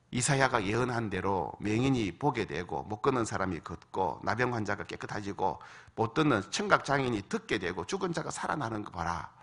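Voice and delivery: usual speaking voice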